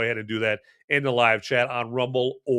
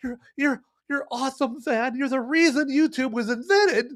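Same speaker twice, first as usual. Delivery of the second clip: higher, gushing fan voice; 9 semitones higher than usual. Pretend. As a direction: play an adoring viewer, much higher. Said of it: "if you think".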